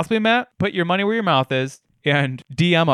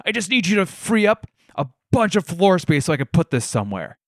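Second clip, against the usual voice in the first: higher, insistent pushy salesman voice; mock salesman, pitched up and pleading; 7 semitones higher than usual. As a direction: voice the pushy guy barging in with a box. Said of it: "And he's like".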